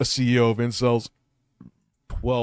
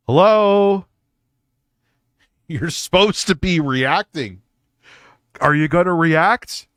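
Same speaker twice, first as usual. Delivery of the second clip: calling out; 6 semitones higher, 11 dB louder than usual. Real, not acted. Calling to an unresponsive guest.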